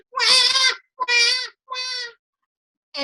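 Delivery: high, loud imitated baby wails; baby crying. Baby crying because everyone screams, imitated wails. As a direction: wail like a crying infant. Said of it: "the baby starts crying".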